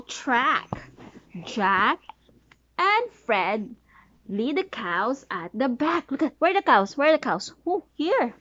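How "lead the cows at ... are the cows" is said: ordinary delivery, nothing marked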